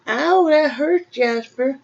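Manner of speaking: sudden high pained yelp. Real, not acted. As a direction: yelp from a cat scratch. Cat claws her leg.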